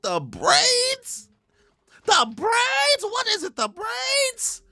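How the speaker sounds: very high excited shouting; screaming with joy at the design; hyped. Real, not acted. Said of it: "hyping over the character design".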